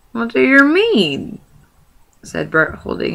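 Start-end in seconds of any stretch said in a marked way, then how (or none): 0.1-1.4 high, loud, slow troll demand; suspicious troll. Acted. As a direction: drag the question out suspiciously. Bert holds him by hair.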